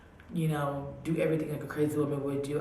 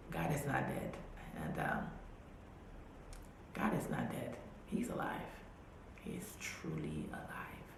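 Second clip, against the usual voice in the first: soft, awed whisper of faith; hushed testimony; 11 semitones lower, 9 dB quieter than usual. Real, not acted. Telling listeners God saved her, hushed whisper. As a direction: whisper it like a secret truth.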